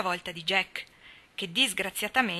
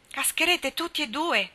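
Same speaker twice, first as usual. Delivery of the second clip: high-pitched excited exclamation; excited cry; 7 semitones higher than usual. A narrator's reading. Ned, agitated below, warning both boys they'll fall. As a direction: blurt it out, jumping with excitement. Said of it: "saltando per l'eccitazione".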